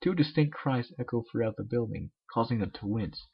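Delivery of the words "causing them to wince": ordinary delivery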